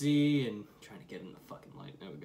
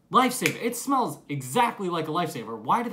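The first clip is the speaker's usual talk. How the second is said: excited realization; 6 semitones higher, 8 dB louder than usual. Real, not acted. Realizing what the smell is.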